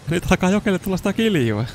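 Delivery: giddy high-pitched commentary; excited. Real, not acted. Excited about game event.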